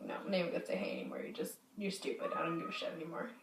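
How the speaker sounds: low, fast, hushed rant under the breath; annoyed muttering. Retold or imitated. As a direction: grumble it to yourself, fed up. Retold annoyance at him.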